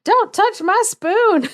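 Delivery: high, loud, drawn-out mock shout; retold shout. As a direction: burst out with it, slow and high, as the kid. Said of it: "so he shouts out".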